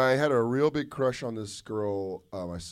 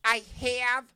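put-on higher, slow flat Ohio accent; a light imitation of a Midwest girl's Ohio accent; 10 semitones higher, much slower than usual. Ohio girl turning down his dinner invite, Ohio accent. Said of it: "in a thick Ohio, Ohio accent".

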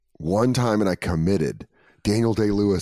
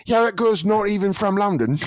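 higher, mock East London voice; East London accent; 10 semitones higher than usual. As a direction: imitate a London friend scoffing. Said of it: "girl from East London".

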